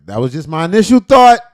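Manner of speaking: loud defensive outburst. Real, not acted. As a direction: blurt it out loud.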